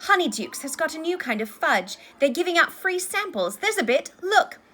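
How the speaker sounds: high, loud, thrilled telling; excited gushing. A narrator's reading. Friends back from Hogsmeade telling Harry everything, excited gushing. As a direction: gush about the wonders you just saw.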